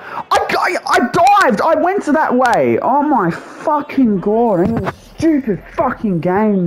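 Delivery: high, stammering outburst of frustration; furious protest. Real, not acted. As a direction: protest at a high pitch, dragging out the curses. Rages after his dive goes wrong.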